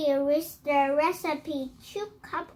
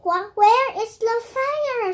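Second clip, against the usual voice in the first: raised, louder storybook shout; fireman's shout; 6 semitones higher, 6 dB louder than usual. Acted. Fireman arrives asking for the fire, acted shout. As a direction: call out urgently as an arriving fireman. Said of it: "shouted a fireman".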